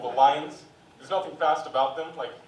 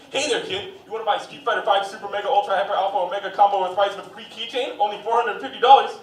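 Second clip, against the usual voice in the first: loud, higher hard-sell pitch; game store clerk hard-selling a kid; pushy salesman; 6 semitones higher, 10 dB louder than usual. Retold or imitated. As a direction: sell it fast and eager. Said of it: "people at the game store who try to sell".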